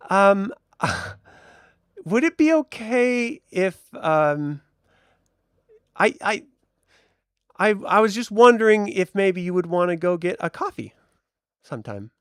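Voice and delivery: shy Susan. Acted. Shop assistant shyly asking Piotr for coffee, high and halting. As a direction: giggle and stumble asking him out. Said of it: "She's like".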